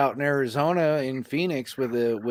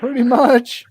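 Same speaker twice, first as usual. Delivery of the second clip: high mocking echo; 12 semitones higher, 8 dB louder, slower than usual. Echoing in a put-on voice.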